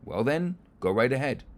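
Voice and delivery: knowing smile. Acted. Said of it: "The farmer smiles".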